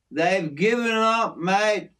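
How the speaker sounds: usual speaking voice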